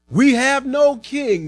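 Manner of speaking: high, slow, emphatic retort; defiant chief priest. A narrator's reading. Chief priests answer Pilate's challenge, defiant. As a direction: answer back firmly, each word drawn out high.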